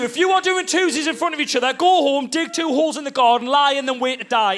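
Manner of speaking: high, mounting comic tirade; outraged rant. Acted. Boring dad laying down toilet rules, outraged. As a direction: rant upwards to a shouted final verdict.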